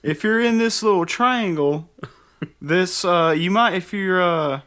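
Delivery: a folksy weatherman giving warnings. Retold or imitated. Weatherman forecast parody.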